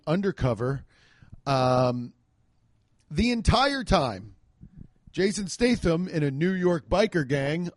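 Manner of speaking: ordinary delivery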